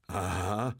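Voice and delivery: triumphant exclamation; crowing discovery; called out. A narrator's reading. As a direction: pounce on it with a drawn-out aha. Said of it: "rief Bartle".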